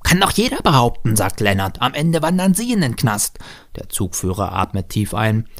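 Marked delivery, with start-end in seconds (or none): 0.0-1.1 put-on high little-boy comeback; child retorting. A narrator's reading. Boy echoing train driver's own words, high retorting voice. 1.8-3.4 put-on high-pitched little-boy threat; child threatening. A narrator's reading. Boy turning driver's threat back on him, high child voice.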